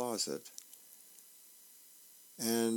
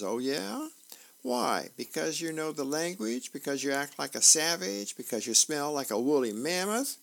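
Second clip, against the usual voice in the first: higher-pitched mocking list of questions; taunting questions; 4 semitones higher than usual. Big sister challenges brother's caveman claim, taunting. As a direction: tease with each question lifting up.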